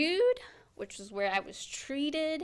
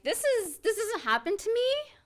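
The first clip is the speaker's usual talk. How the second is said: higher-pitched, mildly alarmed protest; retold disbelief; 10 semitones higher, 4 dB louder than usual. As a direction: voice lifts in retold dismay. Retelling her shock at bad news.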